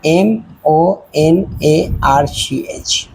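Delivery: letters spelled one by one, very slow; teacher spelling each letter slowly. Shown as a demonstration. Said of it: "ये देखो".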